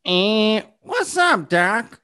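high Bugs catchphrase try; host's Bugs Bunny. Shown as a demonstration. Host tries the full Bugs catchphrase. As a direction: go for Bugs, pitch way up. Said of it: "put the two together".

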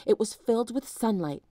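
ordinary delivery, nothing marked

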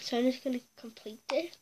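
quick whispered aside; whispering. Real, not acted. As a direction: whisper it to yourself, fast. Muttering while skipping the question.

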